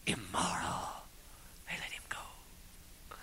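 hushed, breathy whisper; barely audible whisper. Real, not acted. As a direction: drop to a whisper. Hushed telling of Barabbas.

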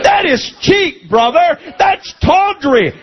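preaching shout. Real, not acted. Preacher denouncing exploitative altar calls.

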